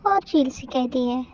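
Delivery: ordinary delivery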